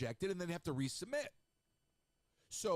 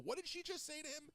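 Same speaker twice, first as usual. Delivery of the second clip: a much higher voice, astonished; astonished disbelief; 11 semitones higher, 5 dB quieter, faster than usual. Retold or imitated. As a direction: squeak it out in shock. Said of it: "I was like".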